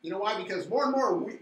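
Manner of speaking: fast, loud and high rallying; rally the listeners; fired up. Real, not acted.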